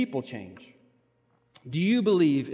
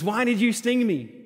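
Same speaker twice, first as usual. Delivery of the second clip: higher, pleading cry; a frog drowning, asking why; dying frog's plea; 6 semitones higher than usual. Retold or imitated. Pastor voicing the dying frog to the scorpion, pleading higher. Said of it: "he says".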